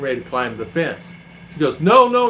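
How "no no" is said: sharp high shouted no, no; retold yell. Wife yelling at a stranger at the fence, loud. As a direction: call it out hard to stop him. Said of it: "when she yelled it out".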